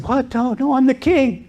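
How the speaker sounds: high-pitched, alarmed objection; startled protest. Acted. Captured king insisting to robbers who he is, startled protest.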